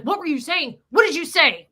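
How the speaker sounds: high, rising-force panicked questions; imitated freak-out. Teller imitates Jeff demanding answers, freaking out. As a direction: fire off alarmed questions, mimicking him. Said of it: "he was freaking out".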